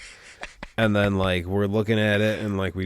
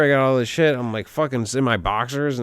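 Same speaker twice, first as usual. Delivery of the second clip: normal talking voice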